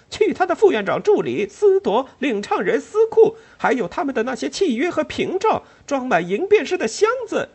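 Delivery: very high, loud curse; furious shout. Acted. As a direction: bellow it defiantly. Ellen cursing from the table.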